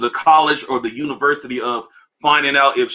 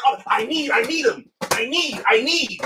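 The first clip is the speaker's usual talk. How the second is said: higher, whiny mock I need; mock needy YouTuber; 8 semitones higher than usual. YouTuber on camera, higher mock pleading. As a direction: beg for the shoes half-joking. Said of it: "the sneaker YouTubers don't help that shit".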